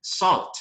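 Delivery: Salt said as "sɔt", no L sound; Salt with open o, silent l; pronunciation model. Shown as a demonstration. Teacher modelling how to say Salt. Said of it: "A pronúncia é diferente".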